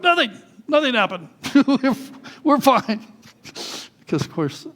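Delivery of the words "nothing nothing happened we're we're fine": high, strained fake nonchalance; pained cover-up. Hurt boys covering up to mom, high squeaky denial. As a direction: squeak out an unconvincing reassurance, half laughing.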